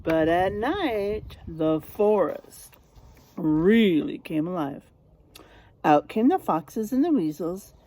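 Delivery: lively storybook narration for a class; reading to children. Acted. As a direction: draw the kids into the tale. Reads picture book to class.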